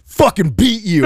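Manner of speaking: high, loud retold gloating; mock triumphant yell. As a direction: crow like a kid who just won. Brother crows after sucker punch.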